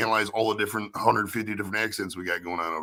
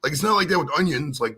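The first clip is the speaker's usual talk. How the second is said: ordinary delivery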